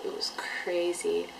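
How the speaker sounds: ordinary delivery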